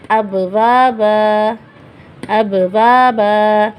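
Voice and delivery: अब वा बा drawn out slowly, a long final आ; slow recitation. Shown as a demonstration. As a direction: chant अब वा बा slowly, holding the last बा. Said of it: "एक ज़बर में".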